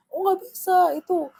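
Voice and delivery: high-pitched, hammed-up outcry; mock yelling. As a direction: imitate an intruder shrilly refusing. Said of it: "Dia teriak".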